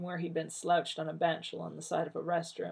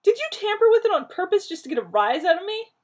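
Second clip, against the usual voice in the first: higher and louder, near cracking; voice soaring high; 11 dB louder, faster than usual. Acted. Rodney accusing John of tampering, voice soaring high. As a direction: push the pitch up in mortified accusation. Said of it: "Rodney's voice soared and cracked".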